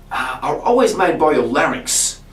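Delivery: usual speaking voice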